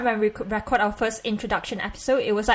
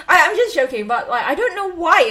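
higher and louder, laughing while backpedaling; 7 semitones higher, 6 dB louder than usual. Real, not acted. Podcaster admits to cohost she was joking, laughing. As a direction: giggle your way out of it. Backpedals on her accent joke.

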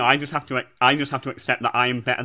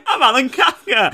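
high laughing chip shop voice; Alan Kafka impression; 12 semitones higher, 4 dB louder than usual. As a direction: pitch it way up through a laugh. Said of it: "You do an impression of Alan Kafka".

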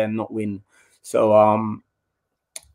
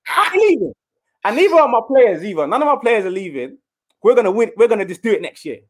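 rally the room like a captain; fired-up rallying speech; 9 semitones higher than usual. Retold or imitated. Player vowing to the gaffer that the squad stays, fired up.

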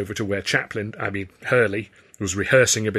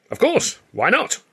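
very high, slow, eager assent; delighted with the notion; enthusiastic; 13 semitones higher, 3 dB louder than usual. A narrator's reading. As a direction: agree eagerly, pitch leaping up. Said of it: "bright with enthusiasm".

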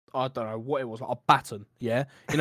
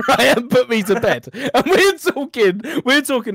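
laughing; 8 semitones higher, 6 dB louder than usual. Real, not acted. Laughing while retelling.

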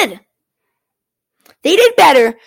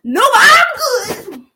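mock goalie quote; 6 semitones higher, much slower than usual. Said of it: "and he's like".

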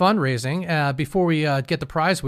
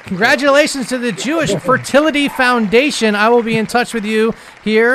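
higher, louder winner call; hyped winner announcement; 5 semitones higher, 4 dB louder than usual. Real, not acted.

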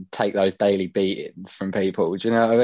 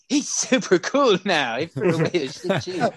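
lightly voiced mock praise; mocking bystander; 6 semitones higher than usual.

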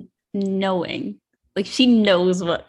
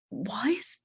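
whisper; 9 dB quieter than usual. Retold or imitated. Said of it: "I was like".

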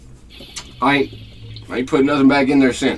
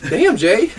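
ordinary talk, nothing marked

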